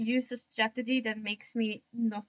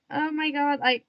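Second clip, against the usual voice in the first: usual speaking voice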